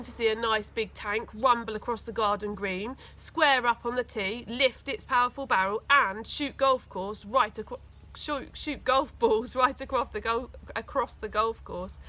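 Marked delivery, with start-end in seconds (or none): none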